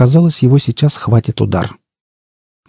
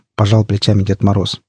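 plain reading voice

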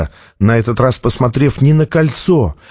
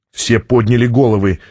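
usual speaking voice

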